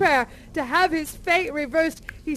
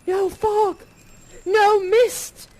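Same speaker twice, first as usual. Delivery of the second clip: joyful wonder; 6 semitones higher, 6 dB louder than usual. A narrator's reading. Joyful morning narration.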